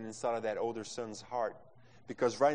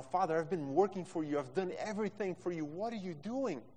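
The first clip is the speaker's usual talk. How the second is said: higher-pitched indignant outburst; angry protest; 6 semitones higher than usual. Acted. Older son protesting to his father, angry and higher. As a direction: protest in mild anger, voice climbing to the question. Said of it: "his anger is gonna come out of him".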